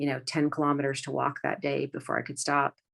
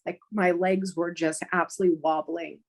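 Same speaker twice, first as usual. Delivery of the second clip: normal talking voice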